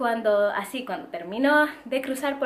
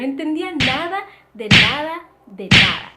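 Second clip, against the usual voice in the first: louder and louder on de nada; emphatic exasperation; 4 semitones higher, 11 dB louder than usual. Real, not acted. Vlogger stressing she understood nothing, building to a loud cry.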